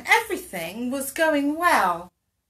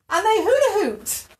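loud, high storytelling lift; excited narration; 9 semitones higher, 7 dB louder than usual. A narrator's reading. Narrator tells children of socks at play, excited. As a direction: top the list with a gleeful high burst.

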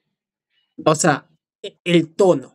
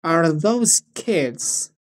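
slow demo; 3 semitones lower, much slower than usual. Shown as a demonstration. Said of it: "podríamos partir".

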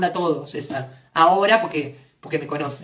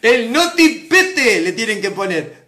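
loud, slow announcement; hammed-up programme title; mock announcer; 7 dB louder, slower than usual. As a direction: boom out each word slowly. Announces joke programme name.

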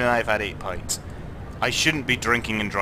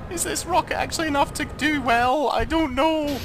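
anxious inner voice. Retold or imitated. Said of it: "'cause I'm like".

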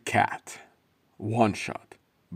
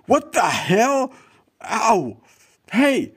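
high, loud panicked yelling; startled yells; 6 dB louder, much slower than usual. Acted. Man jumped on in the dark, panicked yells. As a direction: cry out under sudden attack.

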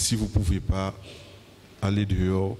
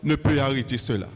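fiery preaching; 7 semitones higher than usual. Acted. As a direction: preach it out with full force. Preacher at full force.

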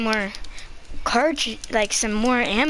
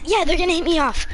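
ordinary delivery

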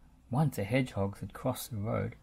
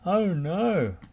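louder, higher dismay; dismayed cry; 5 semitones higher, 5 dB louder than usual. A narrator's reading. Lofty cries out as the tyre flies. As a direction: wail at the missed throw. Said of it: "cried Lofty".

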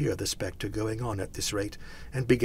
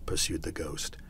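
ordinary delivery

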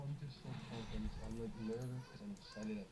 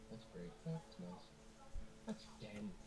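sarcastic; 9 semitones higher, 3 dB quieter, slower than usual. Real, not acted. Sarcastic praise rising.

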